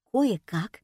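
plain reading voice

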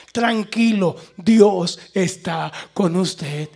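loud, very slow, spaced-out words; emphatic reassurance. Real, not acted. Preacher assuring listeners God is with them, emphatic. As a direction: land each word firmly and slowly.

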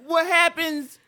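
high, loud stand-up opener; Kelsey Huff impression. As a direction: ham up the comedian. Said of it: "that's a good Kelsey".

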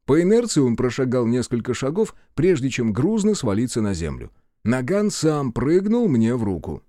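plain reading voice

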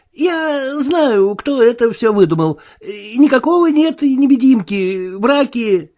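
high-pitched, anxious denial; nervous Marvel. A narrator's reading. Marvel denying the Invisible Man exists, nervous and high.

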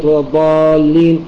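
long held ā in الضالين; obligatory madd. Shown as a demonstration. Teacher giving an example of madd, الضالين with a long drawn ā. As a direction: stretch the alif of الضالين on a steady note. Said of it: "المعبر عنه بالمد اللازم".

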